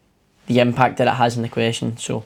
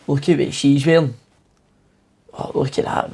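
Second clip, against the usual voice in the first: a hint of catty disgust, last words whispered. Shown as a demonstration. Teacher demoing a catty remark for students, mild disgust. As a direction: sneer lightly at someone's outfit, trailing off to a whisper. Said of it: "if I go up".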